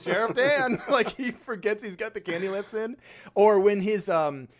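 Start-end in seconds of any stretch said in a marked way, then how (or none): 0.0-0.8 higher crisp phone answer; lightly voiced sheriff; mock sheriff answering. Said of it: "he goes".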